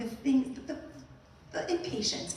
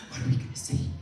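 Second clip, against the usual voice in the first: panicked whispered thought; faster than usual. Retold or imitated. Panicked inner thought, retold.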